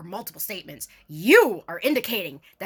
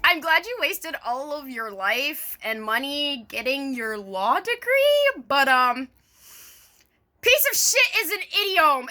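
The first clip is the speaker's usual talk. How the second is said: sarcastic rant; 5 semitones higher than usual. Real, not acted. Vlogger addressing the lawyer directly, sneering and sarcastic. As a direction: lay the sarcasm on thick.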